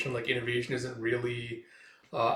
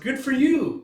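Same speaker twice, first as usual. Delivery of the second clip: very high, louder congratulation; high excited cheer; 13 semitones higher, 8 dB louder, slower than usual. Acted. Urara cheers her friend on, high and excited. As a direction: squeal it proudly.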